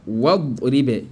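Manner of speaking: "Wa ribe" linked as wad-ribe, no "i" after wa; correct joined reading. Shown as a demonstration. Teacher shows pupils the good reading, joined up. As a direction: join "Wa ribe", skipping the linking hamza. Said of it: "La bonne lecture est quoi?".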